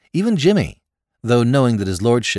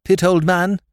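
higher, hearty and warm; warmly; 9 semitones higher, 5 dB louder than usual. A narrator's reading. Lord thanking Jimmy, warm and hearty. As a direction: greet him like a grateful friend. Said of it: "he said warmly".

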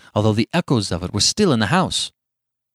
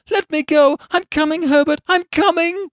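very high, loud, frantic calling; struggling cry; 6 dB louder than usual. A narrator's reading. Mother struggling free, calling to her son, frantic high cry.

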